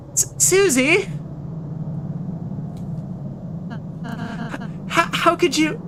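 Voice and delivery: high hurt character voice; betrayed cry. Acted. Mock betrayal by Susie.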